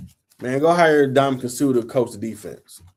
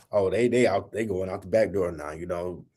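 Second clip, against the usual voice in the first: lower, much softer sneering mock voice; mocking sucker critics; 6 semitones lower, 6 dB quieter than usual. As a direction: mimic the cowardly critics under your breath. That's sucker stuff.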